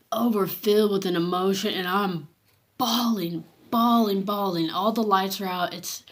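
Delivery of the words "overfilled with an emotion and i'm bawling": usual speaking voice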